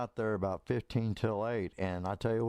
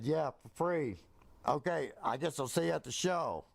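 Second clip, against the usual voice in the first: higher-pitched mild disappointment; sad and mad; 6 semitones higher than usual. Retold or imitated. Unpaid worker answering the boss, mildly sad and mad. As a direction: retell it a little hurt and miffed. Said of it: "kinda sad and mad".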